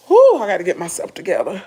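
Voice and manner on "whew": ordinary delivery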